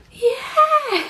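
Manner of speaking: high, loud burst of laughter; delighted laugh. Real, not acted. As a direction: shriek with joy. Dog sees birthday present.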